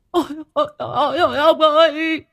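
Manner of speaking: high-pitched, panicked yelps; stammering panic. Acted. Wife finds her hair stuck to the coffin, panicked stammering. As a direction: break from stuttered cries into a loud wail. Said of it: "Bà này ú ớ hốt hoảng".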